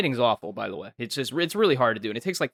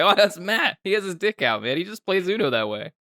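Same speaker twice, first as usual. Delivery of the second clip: defensive friend; 7 semitones higher than usual. Retold or imitated. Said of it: "You're like".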